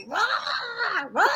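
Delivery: imitated high wail, held back in volume; mock high-pitched holler, drawn out. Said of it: "hooping and hollering in a corner, all loud".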